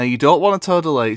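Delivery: ordinary delivery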